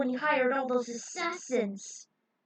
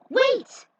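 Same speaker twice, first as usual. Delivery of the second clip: urgent call; 13 semitones higher, 10 dB louder than usual. Acted. Warning friends before door shuts.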